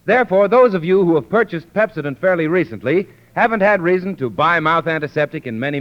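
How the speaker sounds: normal talking voice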